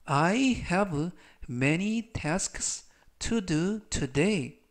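I have many tasks to do today read very slowly; teacher reading the sentence slowly for students to repeat; very slow model reading. Shown as a demonstration. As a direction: stretch every word for learners to repeat. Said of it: "제가 역시 천천히 세 번씩 읽어드리고요".

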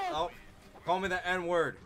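normal talking voice

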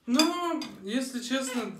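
high, loud burst of protest; surprised outburst. Real, not acted. Sudden objection.

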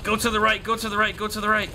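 urgent. Real, not acted. Urging character in panic.

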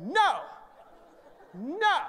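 high, loud repeated refusals; God yelling. Acted. God halting a man mid-step, yelling. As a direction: shout each one as a separate blast. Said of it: "God is yelling at me".

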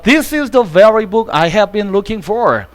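every word of "He is the very man" spaced out very slowly; slow model reading. Shown as a demonstration. English teacher reading the example sentence very slowly. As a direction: read it out word by word for the class. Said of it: "example sentence".

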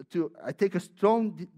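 ordinary talk

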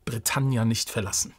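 ordinary talk

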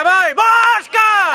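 loud excited cheer; shouting encouragement. Real, not acted. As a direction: urge the player on at full voice. Bench cheering a player. (berro de ánimo)

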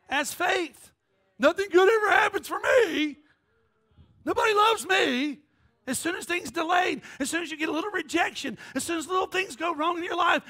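rising sermon shout; preaching. Real, not acted. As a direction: drive it home to the congregation. Preaching at full force.

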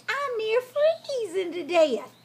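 high, slow pitiful whine; begging fox. A narrator's reading. Freezing fox begs the pig for shelter, pleading. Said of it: "he begged".

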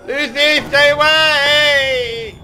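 high drawn-out shriek; terrified scream. Real, not acted. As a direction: scream in terror, slowly. Monster attacking.

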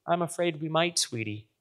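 a touch of a sore-throated mother, softly; soft, lightly hoarse, as if after tears; hoarse from crying. A narrator's reading. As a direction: gently break bad news, voice dropping away. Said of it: "Her voice is hoarse, as if she has a sore throat or has been crying".

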